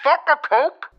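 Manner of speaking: high chime, then announcement voice; high-pitched mock public address; tannoy announcer. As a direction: mimic a shop tannoy. Playing a store tannoy announcement.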